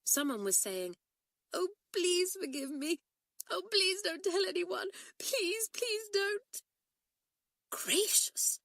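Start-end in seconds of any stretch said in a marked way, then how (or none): 1.5-6.6 put-on high, pleading voice of a crying girl, higher; sobbing plea. A narrator's reading. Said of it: "Someone was sobbing and crying inside". 7.7-8.6 a startled, breathy whisper of alarm; shocked whisper. A narrator's reading. Shocked at sobbing overheard.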